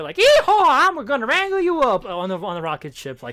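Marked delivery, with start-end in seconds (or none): 0.2-2.0 much higher, louder cowboy holler. Retold or imitated. Comic cowboy villain taunting Power Girl, hammed-up holler. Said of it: "the cowboy".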